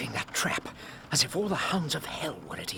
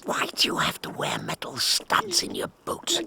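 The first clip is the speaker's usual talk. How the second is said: pained whispered complaint; strained whisper; 5 dB louder than usual. Acted. Watson whispering under Holmes's boots, in pain. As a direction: whisper through the strain of the weight. Holmes standing on his back.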